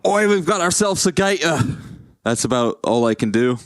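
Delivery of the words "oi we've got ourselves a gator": high Australian accent, hammed up; an Australian accent done on the spot for his co-caster; Steve Irwin. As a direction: do a cheeky Australian wildlife host. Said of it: "how's your Aussie accent".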